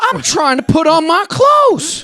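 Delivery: high, loud, drawn-out yell; retold indignant shouting; exasperated shout. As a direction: holler in outraged disbelief. Retold protest at intruders.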